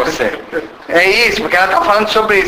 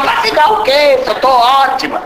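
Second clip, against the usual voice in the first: retruca irritada; 6 semitones higher than usual. Retold or imitated. Namorada retruca.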